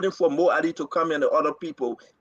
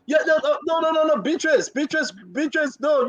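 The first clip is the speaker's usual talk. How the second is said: higher, urgent string of no's; excited protest; 9 semitones higher than usual. Real, not acted. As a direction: cut her off, pitched up and insistent. Objecting to co-host Beatrice.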